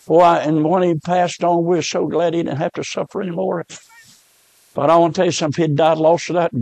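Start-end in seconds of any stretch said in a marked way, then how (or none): none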